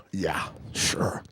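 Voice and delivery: very low, whispered disbelief; skeptical mutter. Acted. Worker doubting Dave would have spoke, skeptical mutter. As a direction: mutter it doubtfully under your breath.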